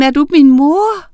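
high, loud, amazed question; excited, urgent shout. A narrator's reading. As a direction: cry out the question, rising. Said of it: "råbte hun".

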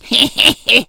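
loud witch cackle; evil cackle. Acted. The evil witch cackling as she grabs Miranda. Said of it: "grinede den onde heks".

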